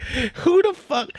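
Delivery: slow, high, incredulous; mock disbelief. S1 reacting to the pencil thief with slow high disbelief. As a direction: drag it out in shock. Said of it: "I was like".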